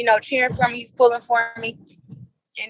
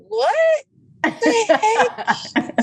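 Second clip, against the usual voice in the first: high-pitched mock bafflement; acted-out, incredulous "what"; baffled reaction; 10 semitones higher, much slower than usual. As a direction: stretch it out, pitched way up in disbelief. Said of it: "And I'm just like".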